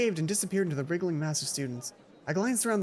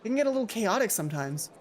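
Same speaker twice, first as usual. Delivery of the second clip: normal talking voice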